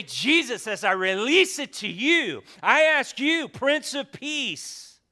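loud, rising plea to Jesus; fervent prayer. Pretend. Preacher showing the church how to pray, fervent loud plea. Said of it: "And you say".

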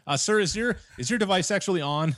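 high-pitched pointed customer-service query; mock tech agent. Tech support asking the caller the basics, mock-tactful. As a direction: imitate an agent stating the obvious. Voicing what tech should have asked.

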